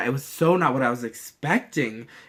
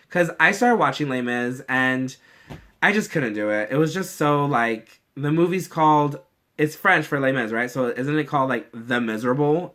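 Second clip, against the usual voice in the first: normal talking voice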